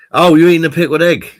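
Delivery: surprised. Real, not acted. Realizes friend is eating.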